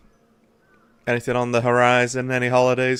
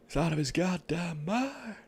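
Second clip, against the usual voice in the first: higher, much softer put-on gruff voice; gruff character voice; 8 semitones higher, 10 dB quieter than usual. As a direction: mimic the game character's growl. Repeating a game character's line.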